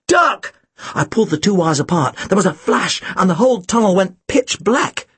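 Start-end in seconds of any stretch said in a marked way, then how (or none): 0.1-0.5 urgent high-pitched command; shouted warning. A narrator's reading. Narrator warning Tim before the shot, shouting. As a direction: cry out to save him. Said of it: "I shouted".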